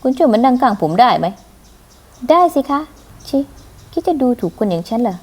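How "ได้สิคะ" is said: high, sugary and meek; sweet little voice. Acted. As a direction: play the demure, cute girl. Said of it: "เอาแต่ทำเสียงเล็กเสียงน้อย".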